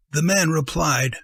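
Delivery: ordinary delivery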